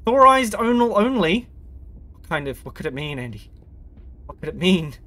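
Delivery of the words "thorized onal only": slow, loud and higher, hammed-up; mock announcer voice. Shown as a demonstration. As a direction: boom it out like a grand announcement. Reading sign in mock voice.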